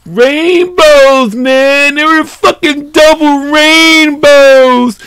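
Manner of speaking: high, loud, drawn-out awe; excited. Real, not acted. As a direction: gush over the rainbows. Excited about rainbows.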